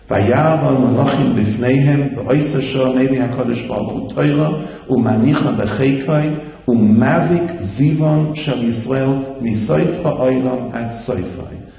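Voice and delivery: loud, ringing declamation of the Hebrew; fervent sermon climax, loud and proud; preaching at full force. Acted. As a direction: proclaim it to the whole world.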